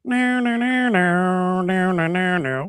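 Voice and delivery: sung-out "neer-neer" noises mimicking a guitar; imitated guitar riff. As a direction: mouth a droning electric guitar lick. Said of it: "he has his guitar and it goes".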